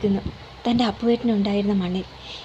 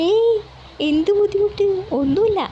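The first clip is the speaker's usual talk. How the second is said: eager welcome; 9 semitones higher than usual. A narrator's reading. Mother assuring the guest she is welcome, eagerly. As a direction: assure her she's welcome.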